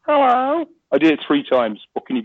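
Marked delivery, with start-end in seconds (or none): 0.1-0.6 long, high cat meow on hello. Shown as a demonstration. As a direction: draw it out like a cat answering, rising. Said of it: "So there's my meow".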